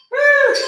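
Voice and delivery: high cheerful whoop; excited whoop. Real, not acted. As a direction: whoop to pump up the class. Cheering the class on mid-workout.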